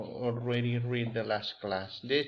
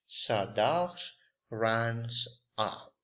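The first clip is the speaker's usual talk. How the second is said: normal talking voice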